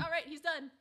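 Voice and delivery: mock dismissal. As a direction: call it out like the job's finished. Voicing writers briskly dismissing a character.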